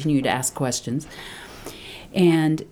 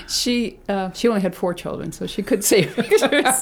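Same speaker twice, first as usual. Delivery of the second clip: higher, laughing through the joke; 6 semitones higher, 3 dB louder than usual. Real, not acted. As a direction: tease with a laugh, pitched up. Laughing joke about her mother.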